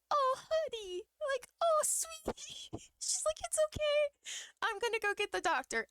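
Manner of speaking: comforting nurse. Retold or imitated. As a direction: coo at her like a hurt child. Nurse comforting crying patient.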